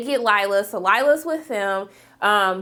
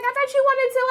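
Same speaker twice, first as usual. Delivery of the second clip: confused henchman; 13 semitones higher than usual. Retold or imitated. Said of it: "He's like".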